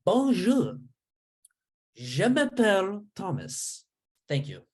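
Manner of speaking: high, bright mock-French greeting, slowly; French accent. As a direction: put on a hammy French accent. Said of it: "try out my French".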